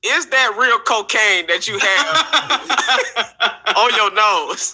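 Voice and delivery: slow, laughing through the question; can barely ask it for laughing. Real, not acted. Host teasing the comic about his nose, laughing. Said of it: "laughing question".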